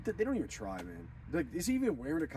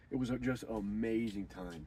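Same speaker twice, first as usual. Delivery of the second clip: normal talking voice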